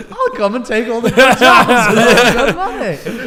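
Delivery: gleeful hammed-up foreigner boast; mock foreigner. Laughing mock foreigner taunting the government.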